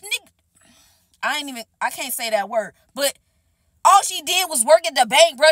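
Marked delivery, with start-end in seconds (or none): none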